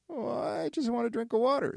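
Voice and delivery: high, meek little wolf. Acted. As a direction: ask sheepishly for water. Wolf explains meekly.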